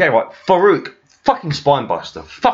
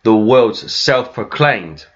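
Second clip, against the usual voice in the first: full title; 9 semitones lower, slower than usual. Retold or imitated. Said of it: "the full title".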